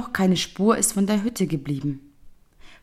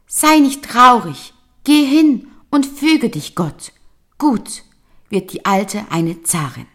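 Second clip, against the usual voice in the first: fish voice; 6 semitones higher, 6 dB louder than usual. Acted. Fish comforting the old man.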